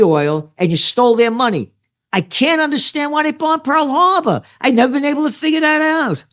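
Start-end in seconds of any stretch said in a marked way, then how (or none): 2.1-6.2 sarcastic, higher, playing dumb; mock bafflement. Host mocks official story with sarcastic bafflement. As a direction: feign confusion with heavy irony.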